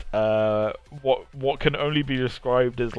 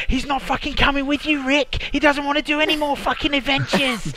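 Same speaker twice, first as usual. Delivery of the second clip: high, shrill-pitched Australian mum telling Rick off; 3 dB louder than usual. Retold or imitated. Morty's mum refuses Rick in a high Australian voice. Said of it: "Morty's mum coming out and going".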